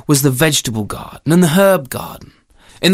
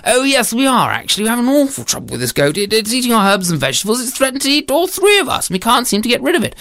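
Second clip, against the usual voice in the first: high, hurried complaint; flustered husband; 8 semitones higher, faster than usual. Acted. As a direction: pour out your trouble to a passer-by. Storyteller voices the husband.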